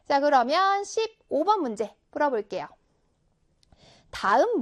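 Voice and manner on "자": normal talking voice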